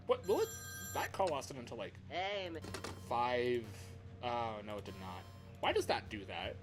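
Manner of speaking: normal talking voice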